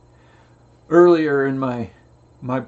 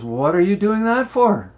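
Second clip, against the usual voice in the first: higher, louder puzzled question; puzzled old man; 7 semitones higher, 4 dB louder than usual. Retold or imitated. Old man asks the boy why he bothers, higher baffled question.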